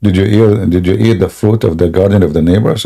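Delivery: ordinary delivery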